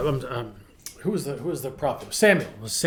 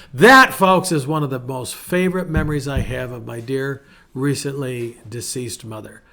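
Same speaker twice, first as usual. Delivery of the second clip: ordinary talk, nothing marked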